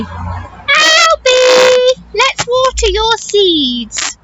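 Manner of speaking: lightly voiced Mum calling out; a mother calling her son.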